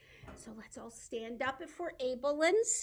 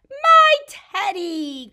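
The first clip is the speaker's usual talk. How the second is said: high, loud, drawn-out cry; hammed-up storybook outcry; 9 semitones higher, 17 dB louder, slower than usual. A narrator's reading. Eddie sees his lost teddy, crying out. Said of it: "cried Eddie".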